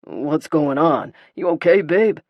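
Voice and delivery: gruff protective male growl. A narrator's reading. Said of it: "a growling male voice".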